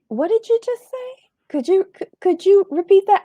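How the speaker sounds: high-pitched mock disbelief; incredulous. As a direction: pretend you can't believe your ears. Imagined outraged reply to husband.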